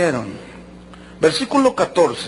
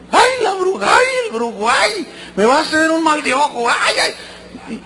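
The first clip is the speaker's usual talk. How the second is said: high, shrill-free wailing mock fright, much higher; mock panic; 8 semitones higher than usual. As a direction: whimper like a scared believer, hammed up. Mocking a fearful believer. (pánico fingido y exagerado, mucho más agudo)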